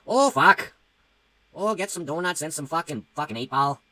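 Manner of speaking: high, loud, drawn-out Canadian accent. Shown as a demonstration. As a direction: put on a big, hammed-up Canadian accent. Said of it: "do a Canadian accent".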